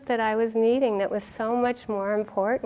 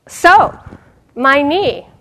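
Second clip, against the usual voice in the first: loud, high drawn-out "So, my knee"; old monk's complaint; 6 semitones higher, 13 dB louder, much slower than usual. Retold or imitated. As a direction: play the monk launching into his ailment. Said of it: "would go".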